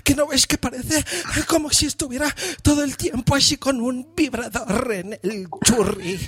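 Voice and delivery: high, sing-song moaning mock Spanish woman; imitando españolas de película. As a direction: whine it high, as if overcome with pleasure. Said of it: "hablan así como si se las". (española de película gimiendo, muy agudo)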